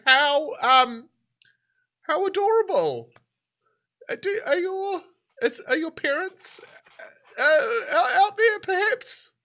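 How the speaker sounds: very high, shaky, nervous woman stammering; frightened captive woman. Acted. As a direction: flatter him through fear, breaking into a laugh.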